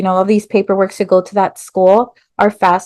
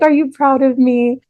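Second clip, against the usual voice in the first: playful tease; 6 semitones higher than usual. Retold or imitated. Playful asking fake dad.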